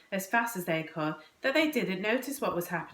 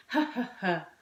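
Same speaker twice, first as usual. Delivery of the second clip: acted ha-ha-ha laugh; mock burst of boyish laughter. A narrator's reading. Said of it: "he burst out laughing".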